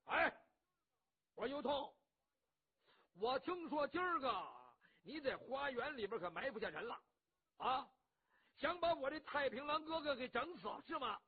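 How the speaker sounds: loud, high hectoring call-out; loud accusing shout. Acted. Cheng Yaojin calls out You Tong for the ambush, loud. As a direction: bark the accusation at him.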